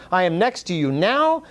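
usual speaking voice

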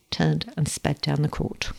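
plain reading voice

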